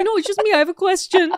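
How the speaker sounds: high-pitched, loud pleading impression; imagined harmless fan. Imagined fan explaining himself to the driver, high mock plea. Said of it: "And he's like".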